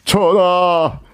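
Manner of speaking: high-pitched, drawn-out historical-drama lament; acted lamenting courtier. Im Sa-hong tells the king of his mother's death, theatrical wailing lament. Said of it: "이렇게 얘기합니다".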